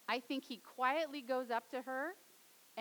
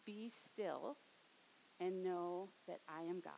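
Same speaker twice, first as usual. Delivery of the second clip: soft, gentle, low-voiced reassurance; calm a grieving widow softly; tender voice of Jesus; 4 semitones lower, 7 dB quieter than usual. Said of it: "he was able to communicate".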